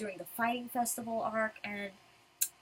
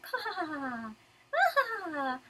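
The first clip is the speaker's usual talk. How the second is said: acted laugh, rising higher; hammed-up character chuckle; mock laughter; 4 semitones higher than usual. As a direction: laugh it off, a little bashful. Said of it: "And he's laughing".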